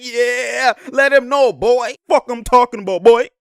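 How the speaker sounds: loud, high hype-man yelling; hyped mock shout. Commentator voices teammates yelling at the defender. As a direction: bark it like teammates egging him on.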